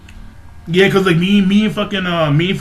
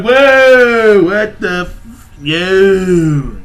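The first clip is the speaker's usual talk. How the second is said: stunned whoa; 4 dB louder, much slower than usual. Retold or imitated. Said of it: "I'm like".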